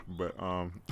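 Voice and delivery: ordinary talk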